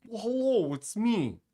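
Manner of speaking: put-on voice-changer sound, starting very high; voice-changer impression. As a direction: pitch it up like a disguised voice, then drop. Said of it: "do an impression of a person's voice on a voice changer".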